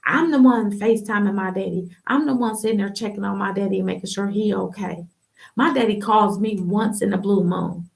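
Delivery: ordinary talk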